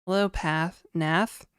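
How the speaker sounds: ordinary talk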